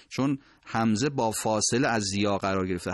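usual speaking voice

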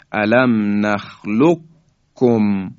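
slow نخلقکم as "nakhluk-kum", k held firm; merged, doubled kaf. Shown as a demonstration. Teacher repeats the merged word, with a firm doubled kaf.

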